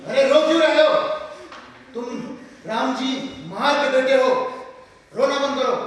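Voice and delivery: loud, firm reprimand; scolding the crying. Acted. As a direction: order crying children to stop, loudly. Father tells crying children to stop.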